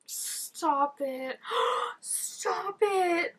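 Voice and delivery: cringing squeal; pained cringe. Real, not acted. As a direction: beg it to stop while wincing. Cringing at a scene.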